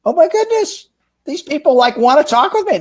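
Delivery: high, delighted squeal of disbelief; young salesman amazed at eager inbound leads; thrilled surprise. Retold or imitated. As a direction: gush like you can't believe your luck. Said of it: "And I'm like".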